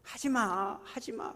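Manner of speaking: imitated child's wail, high-pitched; retold wailing. Older child pleads with a tormenting sibling, mock wailing. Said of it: "막 울부짖어요".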